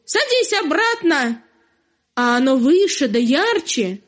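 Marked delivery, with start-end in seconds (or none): 0.1-1.4 shooing rooster. Acted. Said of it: "Кшикает на него".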